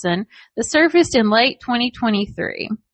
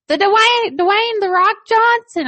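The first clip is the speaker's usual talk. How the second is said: put-on high little-kid voice; high child voice naming the star; imitating a little kid; 10 semitones higher than usual. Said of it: "he kept saying".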